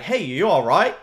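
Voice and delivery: light imitation of an Australian accent. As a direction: try a rough Australian accent. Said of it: "Was that your Australian accent?".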